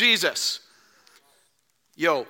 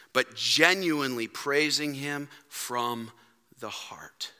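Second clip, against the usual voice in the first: soft intimate plea; 5 semitones lower, 5 dB quieter, slower than usual. Real, not acted. Sermon closing, soft emphasis.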